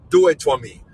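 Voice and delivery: usual speaking voice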